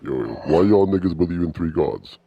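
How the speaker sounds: ordinary delivery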